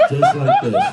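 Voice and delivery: squeaky high fake pained voice; mock injured limp. Comic acting out a fake insurance injury, squeaky. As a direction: ham up the injury for the claim. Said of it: "I'm finna limp just like this".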